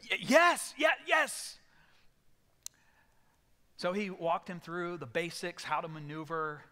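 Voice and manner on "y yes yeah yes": high, loud stammering yes; panicked yes. Retold or imitated. Terrified passenger shouting yes to the controller. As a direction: blurt it out in sheer panic.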